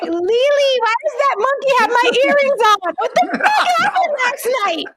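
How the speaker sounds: very high comic wail; hammed-up hysterical shriek of disbelief, half laughing; mock panic. Friend acting out a morning after with a monkey, mock panic.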